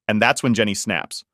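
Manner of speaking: ordinary delivery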